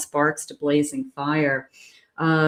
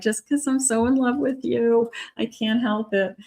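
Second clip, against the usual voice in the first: teary lovestruck voice; 6 semitones higher than usual. Pretend. To a partner who asks why they're crying, a high tearful gush. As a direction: play someone crying happy tears while in love. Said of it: "And you're like".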